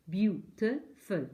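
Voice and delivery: teacher drawing out Beautiful; slow syllable count. Shown as a demonstration. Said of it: "Three syllables".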